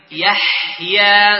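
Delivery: يحيى drawn slowly with a thin ha; slow light-ha chant. Shown as a demonstration. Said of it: "ترقيق الحاء".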